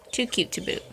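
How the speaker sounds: Too cute to boot whispered softly; whispered coo. Real, not acted. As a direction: breathe it to the birds. Cooing at birds.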